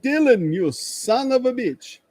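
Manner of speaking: high, slow, hammed-up jab at a friend; mock insult greeting. Greeting Loki across the bar with a playful mock insult. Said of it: "spotted Loki and went".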